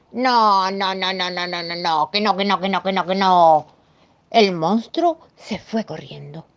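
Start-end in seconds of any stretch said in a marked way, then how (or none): 0.1-3.7 loud first no, then rattled-off nos; refusing monster. Acted. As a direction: dig in like a stubborn monster. Monster refuses to try something.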